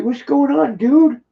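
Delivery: very high put-on pet voice; speaking for the pet. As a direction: squeak out a greeting as the pet.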